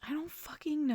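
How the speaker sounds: exasperated whisper; a whispered, stumped curse. Real, not acted. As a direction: hiss it under your breath. Stumped by question.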